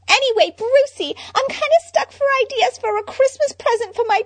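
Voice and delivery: high, loud bubbly Harley. Acted. Harley chatting to Bruce about presents, bubbly. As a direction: gush like a ditzy fangirl.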